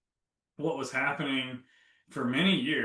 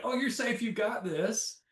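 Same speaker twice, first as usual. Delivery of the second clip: a much higher, hammed-up pep-talk voice, then slowing on You got this; mock a cheesy cheerleader telling herself she's fine; hyped-up cheerleader voice; 9 semitones higher than usual. Telling herself the wrong way, as a higher, hammed-up pep talk. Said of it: "Not like".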